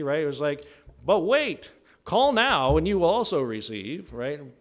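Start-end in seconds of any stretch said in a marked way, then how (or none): 1.0-4.1 loud, high infomercial sell; TV commercial pitchman. Retold or imitated. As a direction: hype it like a late-night ad. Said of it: "sounds like one of those commercials on T V".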